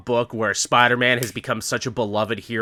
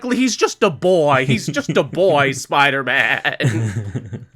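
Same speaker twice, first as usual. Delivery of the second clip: mock-protective pleading; slower than usual. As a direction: plead for the lad, playfully overdone. Mock-protective pleading for young hero.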